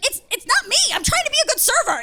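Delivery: panicked whine. Retold or imitated. Server begging the manager not to blame her, in a high fast panicked whine. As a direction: talk fast and high like you're about to get fired.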